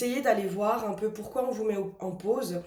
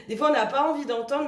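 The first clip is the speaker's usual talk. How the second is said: laughing; 6 semitones higher, 5 dB louder, faster than usual. Real, not acted. Repeats with a laugh.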